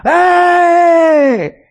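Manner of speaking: high, loud shouted battle cry; war cry. Retold or imitated. Teens answering with a war cry, loud high shout.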